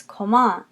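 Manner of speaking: French "comment", accent on the second syllable; second-syllable stress. Shown as a demonstration. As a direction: say "comment" as ko-MAH, no final T. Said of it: "You're going to accent the second syllable".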